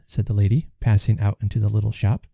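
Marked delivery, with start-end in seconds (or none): none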